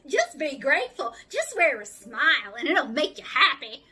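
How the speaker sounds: loud, hammed-up Southern cheer. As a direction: lay on a mocking Southern twang. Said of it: "the real stereotypical Southern accent".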